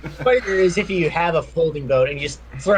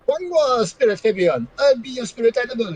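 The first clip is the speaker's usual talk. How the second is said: put-on Jamaican accent; 8 semitones higher than usual. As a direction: play a spirit animal with a Jamaican accent. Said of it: "my tenacious Jamaican accent".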